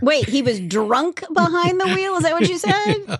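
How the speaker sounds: high incredulous question; disbelieving double-check; surprised. Real, not acted. Disbelief at drunk driver.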